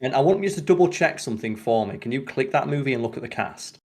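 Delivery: usual speaking voice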